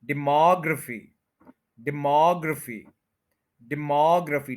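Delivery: Demography as di-MOG-ruh-fi, a quick short ruh; stress on mog. Shown as a demonstration. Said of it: "इसका MOG पे stress है".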